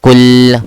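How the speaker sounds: KUL, short u and held l, loud; correct pronunciation. Shown as a demonstration. Teacher modelling Arabic word for student, correct pronunciation. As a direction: hit KUL and hold its L. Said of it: "How do we say?".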